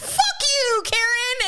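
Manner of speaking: high mock yell at Karen; driver's parting shot. Driver leaving the lot, high mocking yell. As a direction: toss off a high insult while driving away. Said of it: "He's like".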